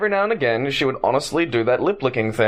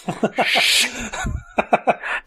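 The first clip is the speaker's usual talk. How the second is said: retold carriage laughter; 11 semitones higher than usual. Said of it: "the whole carriage goes".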